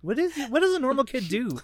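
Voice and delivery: high, loud bewildered parent voice; baffled parent. Pretend. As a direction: wail it as a clueless parent.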